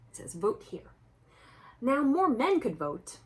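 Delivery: usual speaking voice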